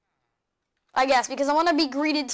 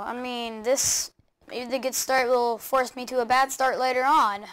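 ordinary talk

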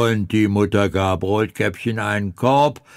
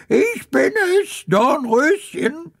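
put-on wolf faking a much higher little-girl voice; disguised girly voice; 14 semitones higher than usual. Wolf at the door fakes a sweet girl's voice. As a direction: squeak it out sweetly to fool her. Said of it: "mit verstellter Stimme".

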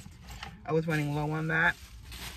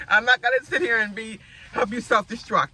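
loud, higher-pitched imitation of a scolding son; mock firm refusal; 5 semitones higher, 7 dB louder than usual. Son to mom about her diet, mock indignant refusal. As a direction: put your foot down, playfully retold.

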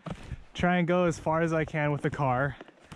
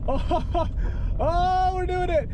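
excited; 7 semitones higher, slower than usual. Real, not acted. Made it through snow.

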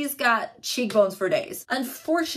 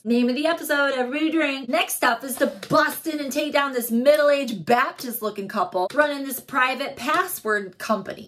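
normal talking voice

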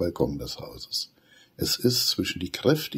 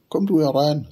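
higher, louder teasing invitation; grinning Benny; 9 semitones higher, 6 dB louder than usual. A narrator's reading. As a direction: tease her to come in. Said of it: "sagt er". (grinsend, neckend)

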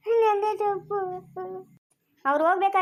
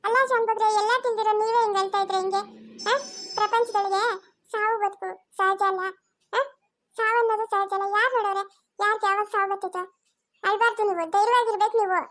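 higher pleading voice of a pandit, urging courage; pandit consoling; 4 semitones higher than usual. Acted. Pandit begging grieving old man not to cry, higher pleading voice. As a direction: plead with him to stop crying, voice lifted.

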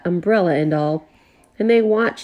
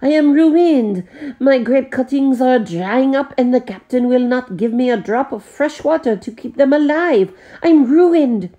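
a Frenchman mourning his dying vines; French lament; 6 semitones higher than usual. A narrator's reading. The Frenchman laments to the butler, high and despairing.

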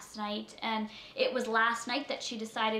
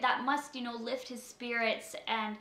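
ordinary talk

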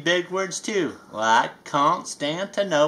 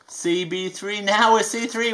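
plain reading voice

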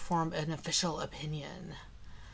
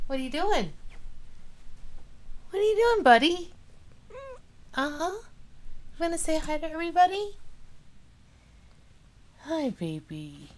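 much higher sing-song voice to the cat; 9 semitones higher than usual. Real, not acted. Cooing at cat.

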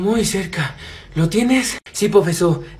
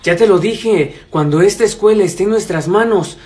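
scheming villain; 9 dB louder than usual. Acted. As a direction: boom it out like a cartoon schemer. Villain plotting to dig treasure.

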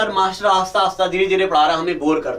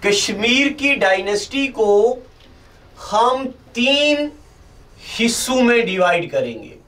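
slow, spaced-out teacher dictation; 3 semitones higher, slower than usual. Acted. Teacher dictating notes slowly to camera. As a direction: read it out slowly so they can write.

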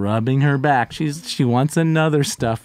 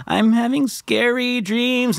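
put-on whiny child; 8 semitones higher, slower than usual. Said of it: "and it's like".

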